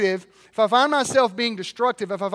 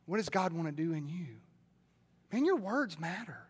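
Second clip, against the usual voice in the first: quiet; 6 semitones lower, 14 dB quieter than usual. Real, not acted. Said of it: "quiet repeat".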